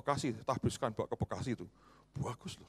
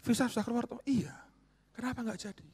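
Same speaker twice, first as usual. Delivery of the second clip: much higher, puzzled insistence; surprised pressing questions; 14 semitones higher, 6 dB louder than usual. Retold or imitated. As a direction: press him high and baffled. Pastor presses friend, retold.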